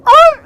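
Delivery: very high, loud squealing "Ah!" like tires chirping; imitated tire chirp. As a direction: shriek it like tires peeling away. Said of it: "you hear".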